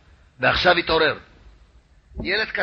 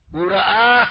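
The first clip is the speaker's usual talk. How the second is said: 1 dramatic emphasis; 3 semitones higher, 8 dB louder, slower than usual. Real, not acted. Preacher builds to dramatic reveal.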